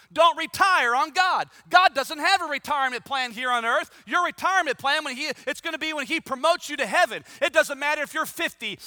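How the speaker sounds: normal talking voice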